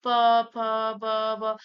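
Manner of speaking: प, फ, ब, भ as separate syllables; labial letters demonstrated. Shown as a demonstration. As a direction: recite प, फ, ब, भ evenly from the lips. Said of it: "आप ओंठ से ही इसकी क्रियाएं".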